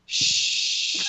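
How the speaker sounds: long, drawn-out hush; mock soothing shush. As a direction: imitate shushing someone upset. Retelling friend hushing him.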